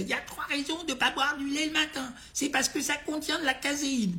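high, mocking imitation of a crank; squeaky voice to make fun of her. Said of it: "avec une voix de Cresset".

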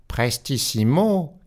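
a high, drawn-out cry from afar; called out. A narrator's reading. The clock's voice calling the waltz tempo, crying out slowly. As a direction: call the tempo like a ringing command. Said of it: "cria la voix".

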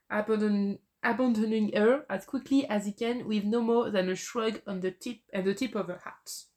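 English read aloud in a French accent; French accent, stumbling slightly through the reading. Real, not acted. Quiz player reads the second sentence as a clue, French accent. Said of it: "mon accent anglais encore".

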